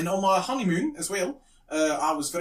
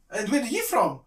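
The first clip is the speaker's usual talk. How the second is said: pitched-up South African question; South African accent; 7 semitones higher than usual. Retold or imitated. Lift couple questioning the drunk husband, South African accent. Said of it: "very, very South African accent".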